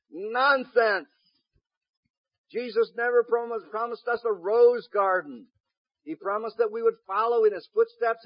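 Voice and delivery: high, loud, forceful preaching; impassioned pulpit shout; preaching at full force. Real, not acted. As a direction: thunder the rebuke to the congregation.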